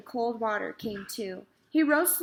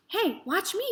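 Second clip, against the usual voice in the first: high, excited call for attention; boy's yell; 9 semitones higher than usual. A narrator's reading. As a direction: show off to everyone. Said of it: "yelled Avery".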